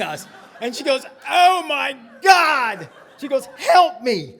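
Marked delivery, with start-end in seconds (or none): 1.3-2.9 loud, high, drawn-out horror; panicked mock cry. Mother spotting the rabbi outside, hammed-up horror. 3.6-4.3 loud, high cry for help; urgent mock plea. Mother needing to hide the Christmas tree, urgent plea.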